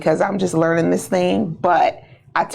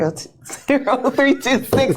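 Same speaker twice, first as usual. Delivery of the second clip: breaking into laughter; 7 semitones higher than usual. Real, not acted. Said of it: "I was doing so good in my professional voice".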